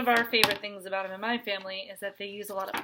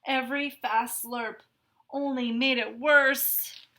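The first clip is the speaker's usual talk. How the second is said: usual speaking voice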